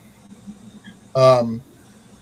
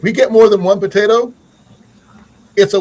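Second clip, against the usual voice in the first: raised, louder and higher voice; yelling a little; 6 semitones higher, 5 dB louder than usual. Real, not acted. Gardener excited about getting more than one potato, yelling a little. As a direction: get carried away, voice rising loud. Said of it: "Sorry if I'm yelling".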